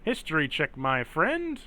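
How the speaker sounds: upbeat robot check. Acted. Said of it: "that robot voice".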